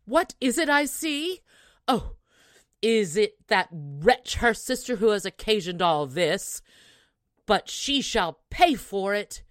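loud, raging outburst; furious mother. Acted. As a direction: blame the other sister with fury. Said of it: "cried the mother".

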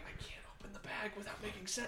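urgent panicked whisper. Retold or imitated. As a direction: whisper it fast, afraid to be heard. Anxious inner voice, unvoiced.

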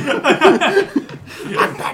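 slow laughing Batman impression. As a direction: drag it out like Batman. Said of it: "maybe I am Batman".

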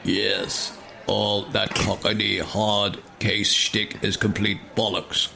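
a lightly voiced grumpy hologram; slow soft dismissive hologram; second hologram. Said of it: "says another".

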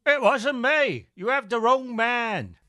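slow, high Michael Caine voice; Michael Caine impression. [name] denies it while hauled from the tavern, as Michael Caine. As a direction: deny it all, Caine style. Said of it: "Michael Caine impersonator".

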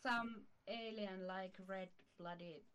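ordinary delivery

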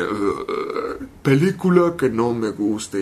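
low, slow, dazed voice; spaced-out Vin Diesel. Retold or imitated. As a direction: drag it out like someone out of it. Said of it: "él está de que". (Vin Diesel ido, voz grave y lenta)

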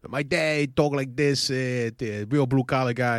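slow, lightly mocking blue-collar dad voice; imitating his dad. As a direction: drag it out like a working-class father. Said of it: "the way I made fun of my father".

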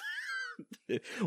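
high shrieking burst of laughter. Real, not acted. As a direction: squeal with laughter. Big laugh after football.

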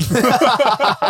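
high burst of laughter. Real, not acted. Telling a mate a drunk story, high laughter.

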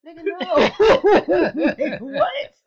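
loud, slow, high "no" through laughter; laughing loud refusal. Real, not acted. Disbelief at upping insurance.

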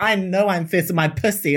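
a different, smug sassy voice; smug mock diva. Cis women accepting compliments, smug mocking diva voice. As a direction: preen like you own the compliment.

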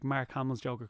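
usual speaking voice

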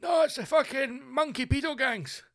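high alarmed exclamation; mock alarm. Walker realising the monkey gangs are coming, alarmed. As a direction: blurt it out in horror. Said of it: "You're like".

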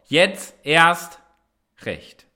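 a loud call to join in, fading at the end; shouted motto. Shown as a demonstration. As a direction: yell the slogan like a rallying cry. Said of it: "und jetzt mach mal mit zu Hause".

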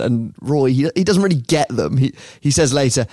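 ordinary delivery

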